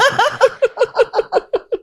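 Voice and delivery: long, high cackling laugh; real laugh. Real, not acted. Storyteller cracking up at her impersonation, real laugh. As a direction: burst out laughing after the tirade. Laughs after acted tirade.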